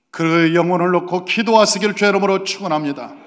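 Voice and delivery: loud pulpit benediction; preaching blessing. Acted. The pastor pronounces a blessing on the congregation, booming from the pulpit. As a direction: proclaim it over the congregation.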